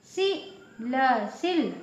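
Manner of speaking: सी as short si, then ल, then सिल. Shown as a demonstration. Teacher building the small-matra word, short vowel. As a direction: spell out सिल with a brief i. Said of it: "छोटी आवाज़ निकलती है".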